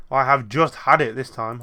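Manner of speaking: loud, slow, fed-up growl of a grumpy townsman; fed-up outburst. Acted. Grumpy townsman ranting to the player, loud and fed up. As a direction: belt it out like he's at the end of his rope. Angry townsman voiced in game.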